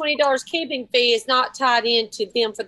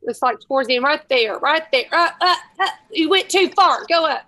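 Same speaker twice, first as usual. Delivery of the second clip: raised voice, higher and louder; urgent directing; 4 semitones higher, 7 dB louder than usual. Real, not acted. Guiding someone scrolling to the right spot, urgent and loud. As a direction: call out directions as the page scrolls past.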